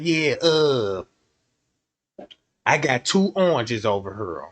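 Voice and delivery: low, gruff lunchroom hustler; deep kid trader voice. Retold or imitated.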